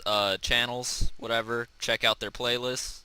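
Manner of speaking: usual speaking voice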